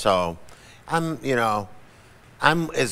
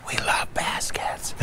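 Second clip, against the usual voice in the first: slow, hushed stage whisper; secretive whisper; 4 dB quieter, slower than usual. Retold or imitated. Family of fans confiding on the street, secretive whisper. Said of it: "like it's a dirty secret".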